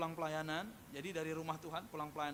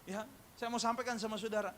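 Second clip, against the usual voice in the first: usual speaking voice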